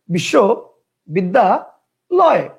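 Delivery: slow বিশ্ববিদ্যালয় in three beats; drawn out in three parts. Shown as a demonstration. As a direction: stretch বিশ্ববিদ্যালয় into three chunks. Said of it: "কেউ উচ্চারণ করতো". (ধীরে তিন ভাগে উচ্চারণ)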